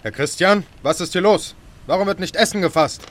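impatient commanding tone; indignant demand. Acted. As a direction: demand answers from your mate. Captain demands an explanation.

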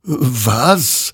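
hoch einsetzend, erstaunt nachfragend; verwunderte Frage. A narrator's reading. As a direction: frag verblüfft, mit gehobener Stimme. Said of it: "wunderte sich dieser". (erstauntes Nachfragen)

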